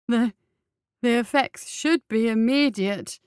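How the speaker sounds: slow, nervous stammer. Acted. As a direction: trip over the first word. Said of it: "the physician stammered".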